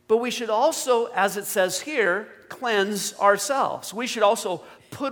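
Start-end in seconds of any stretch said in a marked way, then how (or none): none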